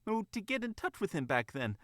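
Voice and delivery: distressed fox mother. Acted. Fox mother begging the bunny to fix this, distressed. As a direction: plead in a high voice, rising to insistence.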